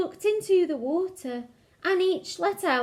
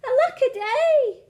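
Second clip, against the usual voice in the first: a loud high wail of woe; high wailing lament; 10 semitones higher, 6 dB louder than usual. A narrator's reading. Sad little dinosaur wailing to himself, loud high lament. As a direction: throw up the voice and wail it. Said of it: "And he cried".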